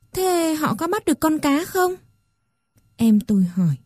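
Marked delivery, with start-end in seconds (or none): 0.1-1.9 put-on higher, softer child's question; small boy asking, soft. A narrator's reading. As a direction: ask like a sleepy little brother. Little brother asks, child voice. (giọng bé trai cao, nhỏ nhẹ)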